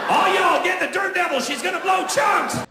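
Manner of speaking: high, loud panicked call; frantic yell. Acted. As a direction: shout for help across the house. Husband yelling for the kids.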